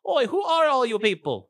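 high attempted accent, calling out; startled crewman challenging strangers; put-on high voice, amateur Scottish try; red-shirt guy. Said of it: "a guy in the red shirt goes".

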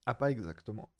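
coughing and choking mid-sentence; sputtering through a coughing fit; choking on a drink. Real, not acted. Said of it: "je m'étouffe".

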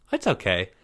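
ordinary delivery, nothing marked

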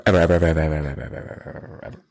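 ever repeated, fading to nothing; fading repetition. Pretend. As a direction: let the evers trail away into silence.

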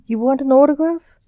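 lightly acted disbelief, pitch climbing to the end; skeptical. A narrator's reading. Magician doubting the ghost's autograph request, skeptical. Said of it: "Blake said skeptically".

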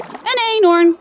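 high loud eager guess; calling out. A narrator's reading. Janna guesses the charade, high loud call. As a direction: shout the answer out. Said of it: "roept Janna".

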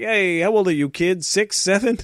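high, mocking grown-up voice; adult teasing a boy who looks seven. Adults teasing small teen.

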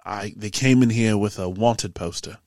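low, measured character voice with dropped r's; serious tavern keeper. Acted. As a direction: recount a threat as a grave warning. Game master voices tavern keeper's warning.

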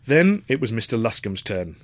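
ordinary delivery

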